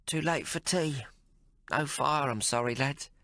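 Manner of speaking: lightly voiced sleepy landlady. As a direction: apologize half asleep.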